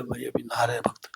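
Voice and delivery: hushed whisper, barely voiced. Temple helper hushing the early visitor, whispered. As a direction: keep it down so the bathers aren't disturbed. Devotees bathing, early morning hush.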